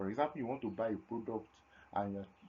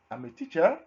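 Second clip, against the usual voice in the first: "I'm a teacher," said louder with "teacher" lifted high; question tune; 6 semitones higher, 14 dB louder than usual. Shown as a demonstration. Teacher showing students a question heard in the tone. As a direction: say it as a surprised question, pitch going up. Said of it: "the rise in tone at that end part".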